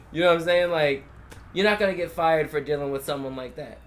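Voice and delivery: ordinary talk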